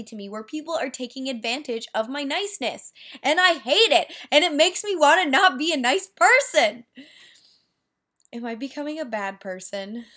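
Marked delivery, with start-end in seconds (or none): 3.2-6.8 louder, higher frustrated yell. Real, not acted. Yelling at viewers about being used, loud and higher. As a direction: shout your frustration at the camera. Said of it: "I was just yelling at the camera".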